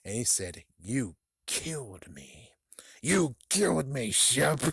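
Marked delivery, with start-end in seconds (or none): 0.8-2.5 slow, breathy ghost whisper; hushed, stretched haunting voice; ghostly accusation. Acted. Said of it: "but he is floating". 3.0-4.6 raised, rasping ghost voice; forceful haunting whisper-cry; ghostly accusation. Acted. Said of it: "but he is floating".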